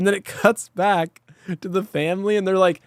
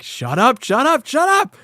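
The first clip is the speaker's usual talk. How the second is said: starting plain, rising into a much higher shout; mock angry shout; 7 semitones higher than usual. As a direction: build from muttered to shouted in rage. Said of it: "so angry".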